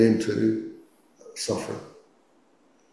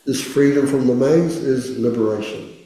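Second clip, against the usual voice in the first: guided meditation. Acted. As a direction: gently guide the listener into stillness. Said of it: "let's do our first meditation".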